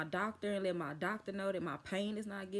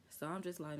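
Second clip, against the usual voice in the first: usual speaking voice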